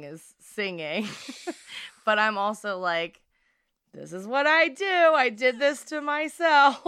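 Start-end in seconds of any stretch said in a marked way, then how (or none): 3.9-6.8 high, loud mock anguish, slowing at the end; hammed-up despair at your own doing; mock lament. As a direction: wail it in playful self-blame. Mock lament over own workload.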